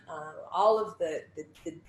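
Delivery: ordinary talk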